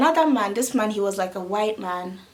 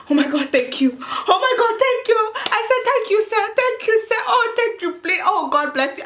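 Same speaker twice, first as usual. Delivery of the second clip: much higher, breathless gushing gratitude; gushing thanks; 12 semitones higher than usual. Retold or imitated. Thanking the stranger who gave twenty euros, gushing and high. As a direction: pour out thanks like he saved your life. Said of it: "raining blessings on the man".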